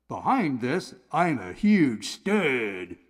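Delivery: slow, low boastful voice; bragging wall. Acted. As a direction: brag slowly like a macho guy. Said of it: "it could say".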